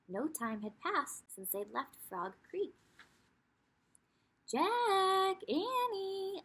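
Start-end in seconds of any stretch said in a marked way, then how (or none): 4.5-6.4 high, loud call across a distance; calling from afar. A narrator's reading. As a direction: call out to the kids far away. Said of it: "a voice called from the distance".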